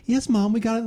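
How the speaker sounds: put-on kid voice. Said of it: "And he's like".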